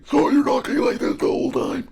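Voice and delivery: gasping inhaled voice; ingressive speech. Shown as a demonstration. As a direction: talk while breathing in, high and strained. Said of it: "I can't do it very well, but I'm gonna do it".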